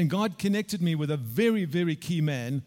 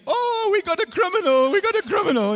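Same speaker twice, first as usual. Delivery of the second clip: much higher, loud mock-gossip voice; 1 mocking crowd voice; 14 semitones higher, 8 dB louder than usual. Onlookers in arrivals hall, hammed-up high mocking voice. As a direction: ham up the gawking crowd.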